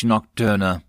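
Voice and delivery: ordinary delivery, nothing marked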